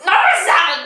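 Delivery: loud restart. Real, not acted. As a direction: call it out. Restarting loudly.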